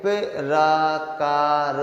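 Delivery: प्रकार stretched out sound by sound; very slow. Shown as a demonstration. Teacher to students, stretching the word out slowly. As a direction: dwell on each sound of प्रकार. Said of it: "रा का उच्चारण पा से पहले".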